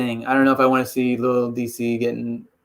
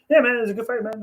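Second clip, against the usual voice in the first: high-pitched, nice-guy fighter impression; Stipe; 8 semitones higher, faster than usual. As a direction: attempt a friendly fighter's voice, pitched up. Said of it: "I can't do an impression of him".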